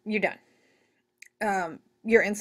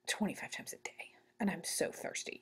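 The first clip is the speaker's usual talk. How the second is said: patient voiced; 2 semitones lower, 15 dB quieter than usual. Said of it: "they're like".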